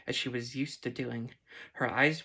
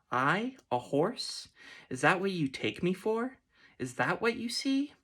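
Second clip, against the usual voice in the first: each question more incredulous than the last; offended disbelief; 6 semitones higher than usual. A narrator's reading. Insulted unicorn questions the man, pitch rising to a squeal.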